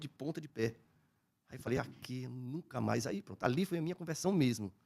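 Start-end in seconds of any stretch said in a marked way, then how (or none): 1.8-3.0 solemn vow. Retold or imitated.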